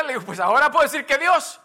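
loud, high impassioned sermon retelling; preaching at full force. Acted. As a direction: build the story to a preaching climax. Preaches retold story at full force. (predicación a todo pulmón, fuerte y alta)

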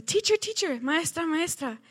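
put-on eager child calling. Boy calls his teacher for attention, high and eager. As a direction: call out like an excited little boy.

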